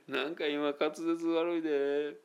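normal talking voice

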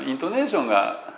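ordinary delivery

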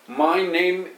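My name said as separate syllables, Japanese rhythm; each syllable of "My name" evenly separate; Japanese-style English. Shown as a demonstration. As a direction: katakana English. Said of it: "とかで言うでしょ".

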